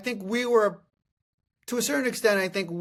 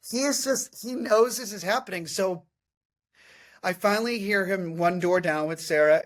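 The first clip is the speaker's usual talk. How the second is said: ordinary talk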